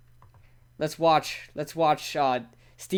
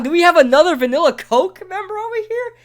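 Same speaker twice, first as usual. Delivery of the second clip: high, loud hype call to the crowd; hype announcer; 12 semitones higher, 6 dB louder than usual. Acted. Streamer hyping up chat about vanilla Coke, loud and high. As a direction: shout it like a stadium announcer.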